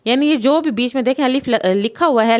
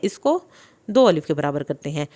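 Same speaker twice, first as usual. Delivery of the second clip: ordinary delivery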